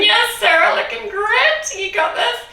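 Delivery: excited cheering. Real, not acted. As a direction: cheer her on like a hype coach. Instructor cheering on a participant.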